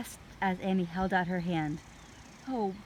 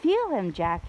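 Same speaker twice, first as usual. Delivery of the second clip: eager; 8 dB louder than usual. A narrator's reading. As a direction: coax him excitedly. Annie urging loudly.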